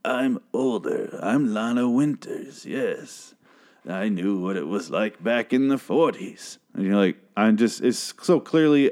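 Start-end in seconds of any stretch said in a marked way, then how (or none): 0.0-6.6 slow, put-on elderly woman's voice; mock old-lady voice. Podcaster mocking actress's older character, put-on old-lady voice. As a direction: draw it out like a badly faked old lady. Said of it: "maybe do a different voice. The voice is the big problem".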